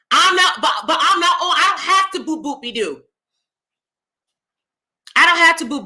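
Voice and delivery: ordinary talk, nothing marked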